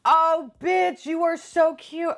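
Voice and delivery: high gushing quote; high, slow adoring gush; gushing squeal. Retold or imitated. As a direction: gush over the figure. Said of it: "I was like".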